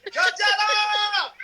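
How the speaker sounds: long drawn-out high shout; festival shout. Pretend. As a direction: belt it out like a festival crowd. Mock festival crowd call.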